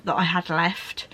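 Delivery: usual speaking voice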